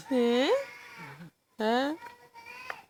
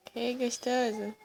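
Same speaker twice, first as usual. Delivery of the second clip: soft cooing; 9 dB quieter than usual. Real, not acted.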